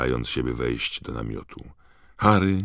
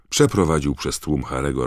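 ordinary delivery

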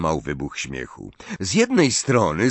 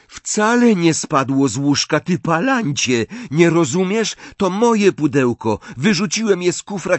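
high, raised, insistent explanation; exasperated argument; 7 semitones higher, 4 dB louder than usual. A narrator's reading. Boy setting his friend straight about chocolates, exasperated. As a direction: hammer each point home in exasperation. Angry explanation during shouted quarrel.

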